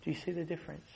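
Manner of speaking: preacher repeats it softly to the congregation; hushed repeat. Shown as a demonstration. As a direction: let the question fall to a near hush. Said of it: "repeated question".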